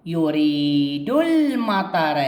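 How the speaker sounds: أريد المطر drawn out slowly, ال not sounded; very slow reading. Shown as a demonstration. Teacher shows silent hamzat al-wasl to pupils, very slow. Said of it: "كتبتها ولكن ما لفظتها".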